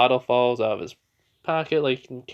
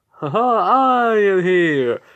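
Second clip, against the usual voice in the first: booming heroic entrance with a big laugh; loud, high, drawn-out triumphant announcement; hammed-up superhero arrival; All Might; 8 semitones higher, 11 dB louder, much slower than usual.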